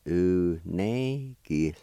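Unegilti drawn out slowly, every syllable clear; slow Cherokee demo. Shown as a demonstration. Teacher drawing out the word for learners. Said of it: "Then together".

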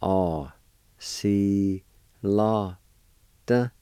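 "Aw, si, la, da" in separate drawn-out syllables. Shown as a demonstration. Teacher showing learners the first part of hammock, syllable by syllable. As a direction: isolate each sound of "Aw, si, la, da". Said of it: "First part is pronounced like this".

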